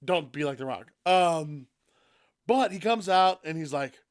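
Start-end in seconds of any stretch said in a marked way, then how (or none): none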